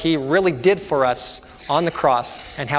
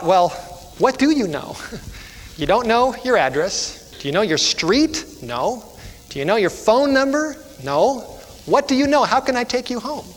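higher-pitched, exasperated questioning, acted; acted exasperation, pitch jumping high on the questions; frustrated policeman; 6 semitones higher than usual. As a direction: grill the child in mounting exasperation. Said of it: "the policeman was getting frustrated".

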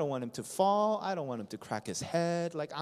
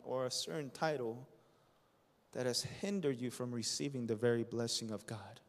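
quiet searching question; 6 semitones lower, 5 dB quieter than usual. Real, not acted. Preacher asking the church a blunt question softly. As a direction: lean in and ask it gently.

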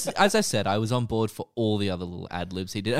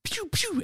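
pew pew sound effect. Retold or imitated. Imitating a water gun shot.

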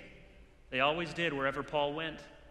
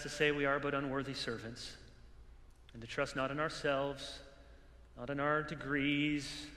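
earnest pastoral prayer, settling softer toward the amen; closing prayer; 3 semitones lower, 4 dB quieter than usual. Acted. Pastor praying over the pastors in the room, reverent prayer.

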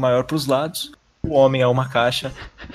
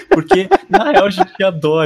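bursting out laughing; 6 semitones higher, 8 dB louder than usual. Real, not acted. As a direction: crack up mid-sentence. Host cracks up.

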